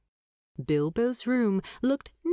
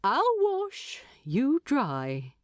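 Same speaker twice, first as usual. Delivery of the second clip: lightly voiced hobbit, brisk; cheerful offer, a touch higher; Bilbo's voice; 5 semitones higher, slower than usual. A narrator's reading. Bilbo offering to wash up, lightly voiced. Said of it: "Bilbo added".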